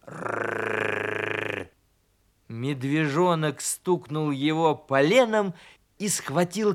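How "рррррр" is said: long rolled wolfish growl; low growl. A narrator's reading. Donkey trying to shout but growling. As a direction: growl low on one steady trill. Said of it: "зарычал".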